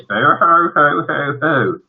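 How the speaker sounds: high, slow mock wailing; whole family boo-hooing theatrically; floods of tears. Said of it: "burst into floods of tears".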